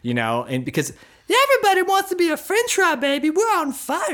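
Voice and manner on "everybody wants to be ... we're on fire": high, loud glam-rock shriek; crazy French fry guy yelling in falsetto. Acted. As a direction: squeal it like a rock star on stage. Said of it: "he sounds like [name]".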